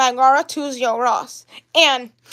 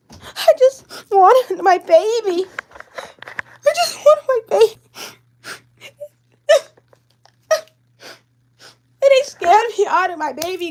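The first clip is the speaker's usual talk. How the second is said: high, drawn-out sobbing wail; tearful crying; 5 semitones higher, slower than usual. Real, not acted. Mourning the baby to viewers, crying. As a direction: cry it out in a high, broken wail. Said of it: "sorry guys, I'm about to cry".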